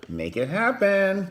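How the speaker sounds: high, loud, slow command; hammed-up coach. Cheering the couple on, hammed-up loud. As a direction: bark the order like a hype coach.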